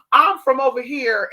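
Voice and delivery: mocking her claim. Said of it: "this bitch talk about".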